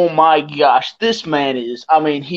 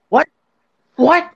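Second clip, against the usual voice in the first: baffled reaction, jumping high on the repeat; mock bewilderment; 10 semitones higher than usual. Friends hearing a drunk man talk philosophy, baffled. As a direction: act out stunned confusion, pitch leaping up.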